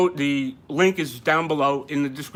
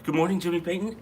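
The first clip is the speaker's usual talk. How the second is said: ordinary talk, nothing marked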